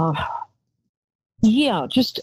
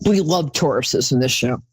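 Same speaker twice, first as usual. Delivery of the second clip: ordinary talk, nothing marked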